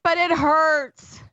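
loud, high, slow wail; mock wailing daughter; imitated crying child. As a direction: protest in a drawn-out kid's whine. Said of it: "she was crying".

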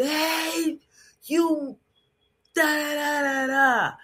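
high mock yell from across the street. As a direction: call out sharply like an annoyed stranger. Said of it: "somebody yells across the street".

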